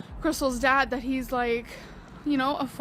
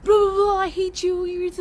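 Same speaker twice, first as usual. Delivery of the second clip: higher, louder, shouting in a fake fight; imitating a heated blowup; mock argument yell; 5 semitones higher, 4 dB louder than usual. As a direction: act out someone screaming mid-argument. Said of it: "they're like".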